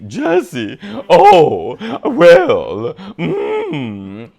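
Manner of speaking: Jesse impression. Fan mimicking Team Rocket's Jesse, high hammed-up voice. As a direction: ham it up like a cartoon schemer.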